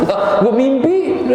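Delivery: slow, high put-on husband voice; dreaming husband. Teacher voicing a husband who only dreamt it, slow and high. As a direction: draw it out like a sheepish husband caught out.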